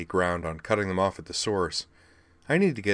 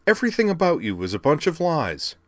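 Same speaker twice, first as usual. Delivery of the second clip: higher, indignant outburst; exasperated accusation; 10 semitones higher, 5 dB louder than usual. Acted. Sylvie accusing Soundwave of lying, indignant. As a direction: fling it at him, fed up. Sylvie throwing up her hands.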